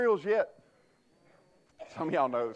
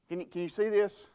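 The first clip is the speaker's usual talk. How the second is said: normal talking voice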